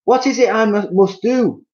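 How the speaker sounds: wife clutching her cheeks in fear; Missus Twit crying out. Acted. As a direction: stumble on it in panic. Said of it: "cried Missus Twit".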